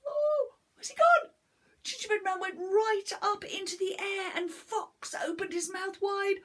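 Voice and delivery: high, loud, quick storytelling; breathless dramatic read to a child; excited climax. A narrator's reading. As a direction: gasp out the danger.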